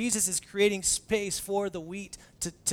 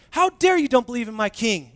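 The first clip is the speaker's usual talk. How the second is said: indignant; 6 semitones higher, 12 dB louder than usual. Retold or imitated. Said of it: "the way they asked it is like".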